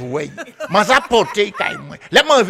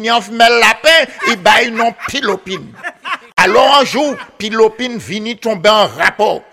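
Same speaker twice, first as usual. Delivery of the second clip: plain reading voice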